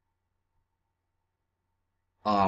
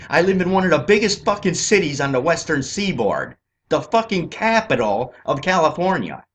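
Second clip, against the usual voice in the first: louder, pitched-up furious ranting; heated, raised voice; angry rant; 3 semitones higher, 6 dB louder than usual. Real, not acted. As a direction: get worked up and let it rip about the camps. Ranting about camps near his home.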